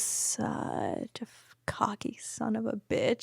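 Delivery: whispered aside. Real, not acted. Confessing past self.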